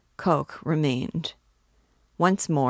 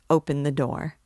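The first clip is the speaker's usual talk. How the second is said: usual speaking voice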